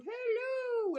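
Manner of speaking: tiny squeaky critter voice, very high; high cartoon crawdad saying hello. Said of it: "did that little crawdad going".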